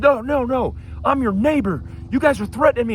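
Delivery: high-pitched imitation of an agitated neighbor; hammed-up indignant accuser, pitched high; mock wound-up protest. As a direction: mimic him protesting in alarm. Imitating wound-up neighbor refusing the phone.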